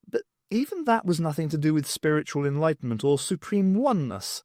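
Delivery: usual speaking voice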